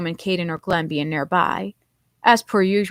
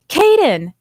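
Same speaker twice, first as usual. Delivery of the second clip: higher, loud hopeful call; excited yell; 8 semitones higher, 7 dB louder than usual. A narrator's reading. Calling after a lost friend's footsteps, excited yell. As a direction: shout his name into the woods, eager. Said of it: "excitedly yelled".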